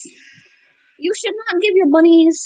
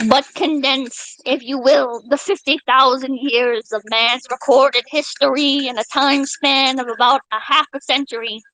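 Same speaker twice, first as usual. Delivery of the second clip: slow, low oratorical delivery; formal speech reading; 4 semitones lower than usual. Acted. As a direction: read it like a grand speech. Reads JFK moon speech.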